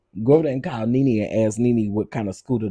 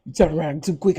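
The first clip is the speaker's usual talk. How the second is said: higher, scolding warning; higher mock scold laughing; 7 semitones higher than usual. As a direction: tell her off like a worried auntie. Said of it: "I said".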